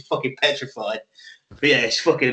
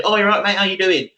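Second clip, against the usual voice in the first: higher-pitched, chummy hello; bouncy, upbeat matey voice; chirpy greeting; 6 semitones higher, 3 dB louder than usual. Retold or imitated. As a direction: greet a stranger like an old mate. Voices friendly greeting to cashier.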